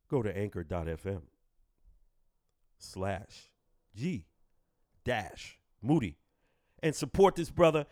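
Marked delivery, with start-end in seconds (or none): 0.1-6.2 low, smooth radio announcer read. Shown as a demonstration. Podcast host repeating the link in his radio voice. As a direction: drop into a deep DJ voice to plug the link. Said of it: "in my radio voice".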